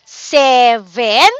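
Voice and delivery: "seven" in two separate beats, se-ven; syllable by syllable. Shown as a demonstration. Teacher reading word list with kids, syllables drawn apart. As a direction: give each syllable of "seven" its own beat. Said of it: "let's read out loud the following word".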